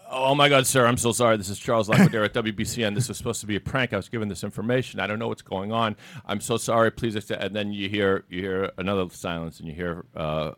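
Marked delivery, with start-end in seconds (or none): 0.1-7.4 higher, louder, rushed panic; mock-panicked radio host begging forgiveness; panicked apology. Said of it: "Charles panics and says".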